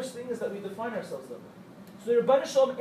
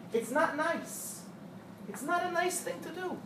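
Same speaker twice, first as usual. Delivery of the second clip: very slow and softer, rising higher; gentle chiding; 7 semitones higher, 6 dB quieter, slower than usual. Real, not acted. Teacher gently telling the girls to stop blaming themselves, higher and slower. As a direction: soften and plead, like coaxing a friend.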